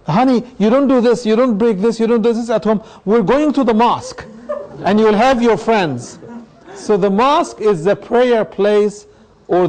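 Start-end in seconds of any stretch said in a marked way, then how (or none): 0.1-6.2 lightly voiced parent, pitched higher; higher, coaxing parent talking to a child; a parent explaining the outing to their honey. Parent's indulgent talk to child.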